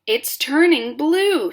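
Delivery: high, slow alarmed scream. A narrator's reading. Mother screams about the nose, high and slow. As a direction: cry it out in horror. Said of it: "screamed Missus Beauregard".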